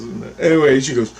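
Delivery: ordinary delivery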